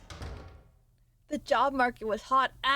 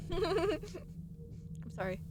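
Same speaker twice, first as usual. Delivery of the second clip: quiet laughing sorry; soft giggly apology; 5 semitones higher, 10 dB quieter, much slower than usual. Real, not acted. Streamer apologizes to chat, giggling softly.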